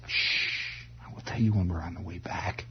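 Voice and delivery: soft, low shushing mother; hushing. Acted. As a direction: hush him quietly, putting him off. Mother hushes son at funeral.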